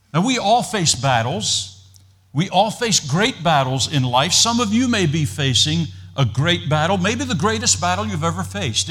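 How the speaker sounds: rally them like a preacher in full flow; rousing preaching. Acted. Pastor urging his congregation through their battles, rousing preaching.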